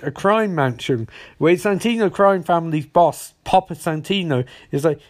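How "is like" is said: ordinary talk, nothing marked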